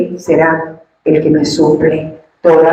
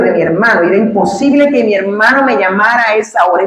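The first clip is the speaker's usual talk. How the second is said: ordinary delivery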